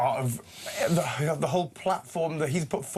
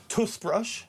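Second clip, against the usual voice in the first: higher-pitched Welsh-accented demo word; Welsh pronunciation; 8 semitones higher than usual. Shown as a demonstration. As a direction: show how a Welsh speaker says it. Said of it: "Some words, though".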